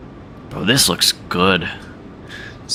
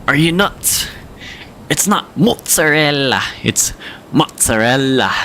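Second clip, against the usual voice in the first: higher, imitated Italian New Yorker; New York Italian accent; 6 semitones higher than usual. Streamer imitating indignant TikTok kid, New York Italian accent. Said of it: "he says it in the New York accent with the Italian accent".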